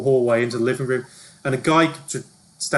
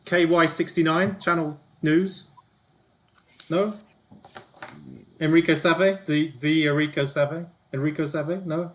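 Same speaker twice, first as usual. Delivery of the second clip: higher-pitched, fishing for recognition; self-important TV reporter; 5 semitones higher than usual. Acted. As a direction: prompt them as if you're famous. Plays TV reporter character.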